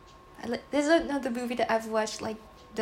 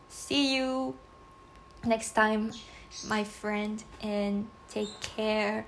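ordinary talk, nothing marked

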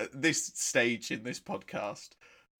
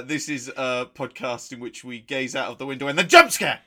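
mock spooky host; 9 dB louder than usual. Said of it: "Can I try one?".

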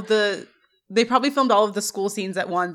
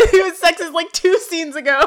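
high, louder giggling delivery; laughing; 6 semitones higher, 4 dB louder than usual. Real, not acted. Cohost agreeing through laughter that Sean was just sexist. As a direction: crack up while you say it.

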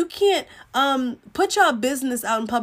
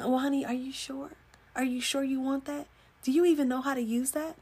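softly condescending doubt; acted patronizing "oh, honey" tone; belittling; 7 dB quieter than usual. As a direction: question your partner as if he were clueless. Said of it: "That's belittling him".

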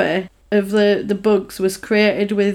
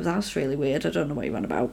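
much softer, lower brush-off; dismissive mumble; 7 semitones lower, 10 dB quieter, faster than usual. Retold or imitated. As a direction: mumble it to wave him off. Staff dismiss policeman.

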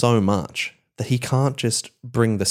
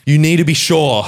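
raised, insistent plea from a father; urgent dad; 6 semitones higher, 6 dB louder than usual. Acted. Dad double-checking his son's clown college news, urgent and loud. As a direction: press it hard, high and loud. Acted worried dad warns son.

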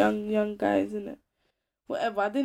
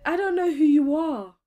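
incredulous; 5 semitones higher, 5 dB louder than usual. Retold or imitated. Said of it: "I said".

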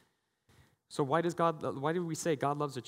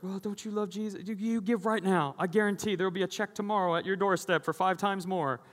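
high, slick salesman preacher voice; mock prosperity preacher; 5 semitones higher than usual. Pastor mocking a preacher who pressures people to give, high mock voice. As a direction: ham up a pushy preacher promising returns. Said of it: "where it's like".

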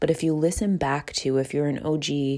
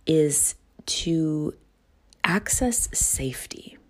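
whispered; much slower than usual. Real, not acted. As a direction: whisper the key words slowly. Whispered key tip.

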